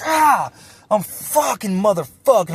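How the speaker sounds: high, loud yell bursting into swearing; reenacted startled outburst. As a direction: act out a fight-or-flight explosion of cursing. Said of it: "fight or flight kicks in".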